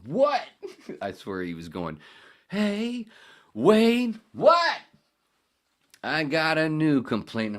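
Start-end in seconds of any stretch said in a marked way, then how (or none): 0.0-0.4 shrieked high, loud what; loud shocked yelp at the radio; startled what. Retold or imitated. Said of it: "I turned to the radio going". 2.5-7.6 much higher, much louder nagging mom call; mom yelling. Retold or imitated. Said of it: "my mom".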